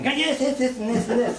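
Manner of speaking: high-pitched mock-angry rant; imitating a fuming complainer; angry customer. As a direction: jab out each item like an irate client. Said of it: "she's all angry".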